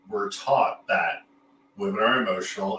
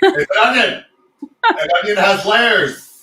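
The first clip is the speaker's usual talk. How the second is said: much higher and louder, laughing through it, slowing on the second line; joking onion line; 10 semitones higher, 10 dB louder than usual. Pretend. Host riffs on the therapist's onion image, loud and laughing. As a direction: play up the onion joke with a laugh.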